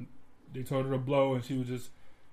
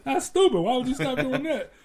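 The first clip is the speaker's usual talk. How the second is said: high, loud teasing with a laugh; mock him, pitch shooting up; mocking laughter; 7 semitones higher, 6 dB louder than usual. Teasing the friend about drinking and driving, laughing loudly. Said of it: "I just kept saying".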